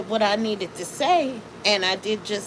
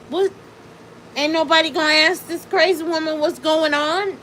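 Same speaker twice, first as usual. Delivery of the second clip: incredulous question to group; 7 semitones higher, 4 dB louder than usual. Retold or imitated.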